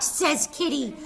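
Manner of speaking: plain reading voice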